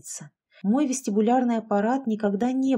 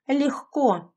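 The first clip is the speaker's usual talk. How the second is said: higher, sharp bark; barked retort; 6 semitones higher, 3 dB louder than usual. A narrator's reading. Roman answers how he found the girls, barking it. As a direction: throw it back as a quick bark. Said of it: "рявкнул Роман".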